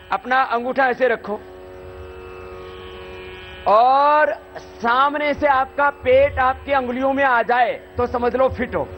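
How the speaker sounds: normal talking voice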